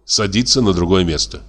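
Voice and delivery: ordinary delivery, nothing marked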